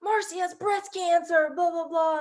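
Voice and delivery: high, slow mock yell; imitated yelling. Mom passing the cancer news to dad, imitated yelling. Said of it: "my mom's yelling".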